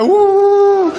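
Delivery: very high "woo" wail; put-on high hooting sob; mock whimpering cry. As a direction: cry through pursed lips. Child muffling cries after scolding.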